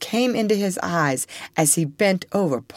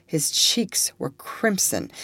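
ordinary delivery

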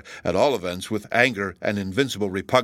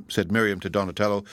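ordinary delivery

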